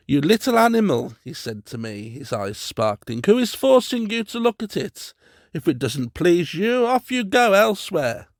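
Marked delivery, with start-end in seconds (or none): 0.1-1.1 high, loud, fast scolding; angry rebuke. A narrator's reading. Tutor scolding the boy for insulting his spider, angry rebuke. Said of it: "Evidently he was angry". 3.2-8.3 high, loud angry dismissal; angry retort. A narrator's reading. Tutor telling the boy to leave, high loud angry retort. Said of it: "Evidently he was angry".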